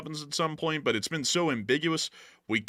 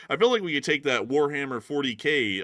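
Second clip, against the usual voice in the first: ordinary talk, nothing marked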